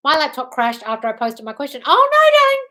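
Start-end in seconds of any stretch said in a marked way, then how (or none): 1.9-2.7 high, loud "oh no"; high-pitched outburst of sympathy; exclaimed sympathy. Real, not acted. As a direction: cry out in exaggerated dismay. Dismayed reaction to viewer's crashed laptop.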